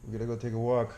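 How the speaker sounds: hushed, sleepy coaxing to the dog; soft talk to dog. Real, not acted. Owner coaxing his dog out of bed, soft and hushed. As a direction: murmur gently to wake him.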